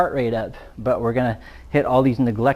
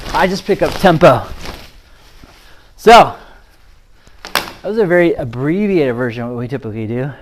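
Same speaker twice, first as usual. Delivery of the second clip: breathless from the workout; talking between heavy breaths; 2 semitones higher, 5 dB louder than usual. Real, not acted. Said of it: "you can tell I'm already out of breath".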